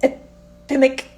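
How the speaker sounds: "epimic" said slowly while fumbling it; a slow, laughing stumble over "epimic"; fumbled word. Real, not acted. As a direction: sound out "epimic" haltingly, syllable by syllable. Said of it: "I can't say this word".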